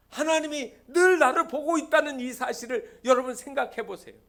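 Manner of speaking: high, loud pulpit exhortation; forceful preaching. Real, not acted. Preacher urges congregation that God is watching, forceful preaching.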